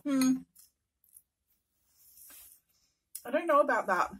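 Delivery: speaking with food in mouth; words muffled by chewing; chewing while talking. Real, not acted. Seen 2: chews after eating noodles; jaw and pressed lips moving at start.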